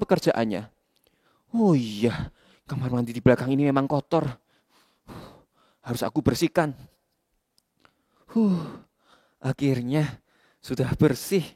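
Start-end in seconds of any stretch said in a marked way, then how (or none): 1.5-11.5 muttering to himself. Acted. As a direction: murmur the chore aloud. Kaf talking to himself.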